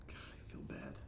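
soft, low, guilty whisper. Real, not acted. Alone at night, whispering his guilt about lying. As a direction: confess it in a hush.

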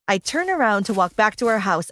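plain reading voice

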